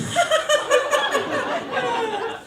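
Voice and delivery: very high imitated maniacal giggle; hammed-up high, long crazy laughter. Heroine in the rainy rowboat, imitated maniac laugh. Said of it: "she starts laughing like a maniac".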